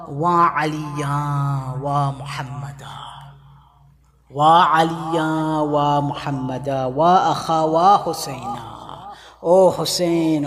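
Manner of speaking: low, slow, drawn-out wailing cry; keen the names like a dirge; slow mournful lament. Acted. The women calling their dead kin, slow keening lament. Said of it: "to yell out".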